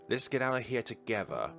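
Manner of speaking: ordinary delivery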